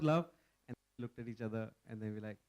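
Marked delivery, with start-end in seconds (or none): none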